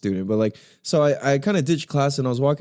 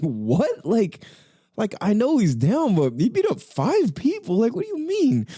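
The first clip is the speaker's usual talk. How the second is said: rising, high-pitched bewilderment; incredulous disbelief; 10 semitones higher than usual. Retold or imitated. Ex hearing about the five-person beating, high and incredulous. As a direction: question it like you can't believe it. Ex reacting to wild rumor.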